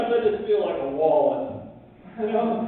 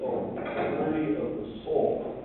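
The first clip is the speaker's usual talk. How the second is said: churchy hush; 4 semitones lower, 7 dB quieter, slower than usual. Retold or imitated. Quiet church reply.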